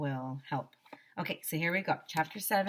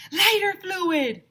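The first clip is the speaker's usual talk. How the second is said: eager shout; 13 semitones higher, 10 dB louder, slower than usual. Acted. Kid answering eagerly.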